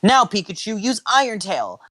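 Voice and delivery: high-pitched battle command. Acted. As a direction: order the attack with spirit. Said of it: "Ash commanded".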